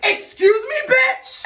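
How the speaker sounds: indignant yell. Retold or imitated. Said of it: "I was not fucking having it".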